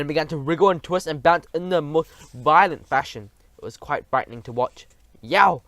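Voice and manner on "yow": loud sleepy giant roar; a giant's yowl. A narrator's reading. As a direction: bellow it out in pain. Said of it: "roared the flesh lump eater".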